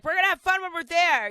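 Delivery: chirpy vacation promise; mock cheerful. Parent promising vacation fun, mock cheerful. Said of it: "you're like".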